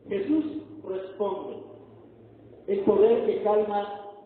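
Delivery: loud, ringing preacher's proclamation; full-force preaching. Acted. Preacher booming the sermon point to the congregation. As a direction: proclaim it to the whole church.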